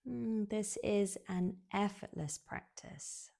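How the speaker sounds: usual speaking voice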